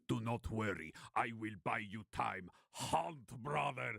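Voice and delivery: heroic warrior's voice, rising to a cry; Spartacus. Acted. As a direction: reassure your comrades, then pitch up into a rallying call. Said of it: "Spartacus looks at all of you and says".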